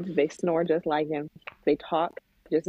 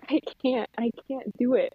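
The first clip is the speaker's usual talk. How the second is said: put-on whining child; 7 semitones higher than usual. Voices crying four-year-old son.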